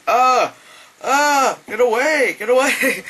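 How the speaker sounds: high, loud panicked retreat; mock-panic, shooing it away; acted recoil.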